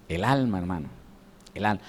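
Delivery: almost whispered, intimate repetition; hushed emphasis. Real, not acted. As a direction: drop the voice low and soft on "el alma". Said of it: "preacher drops voice for emphasis". (susurrando con énfasis)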